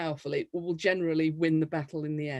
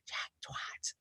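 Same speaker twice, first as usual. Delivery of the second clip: whispered; 16 dB quieter than usual. Retold or imitated. Colleague whispers to newsreader to make her laugh, whispered. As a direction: say it in a hushed whisper. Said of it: "They just whisper".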